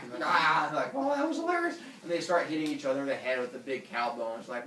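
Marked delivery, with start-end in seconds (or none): 0.3-0.7 short loud yelp; comic cry of pain; mock pain yell. Friend whacked with a bone. 1.0-1.8 high, loud mock amusement; hammed-up dim-witted glee; goofy delight. Humans delighted by the whack.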